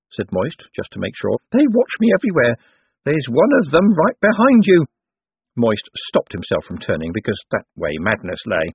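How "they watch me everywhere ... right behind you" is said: high, loud, agitated character voice; paranoid little man. A narrator's reading. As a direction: warn him in a high, frightened voice. Paranoid Owlswick speaking.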